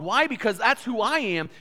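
normal talking voice

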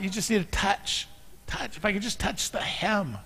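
hushed longing. Real, not acted. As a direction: draw them in close, almost to a hush. Tender plea to reach Jesus.

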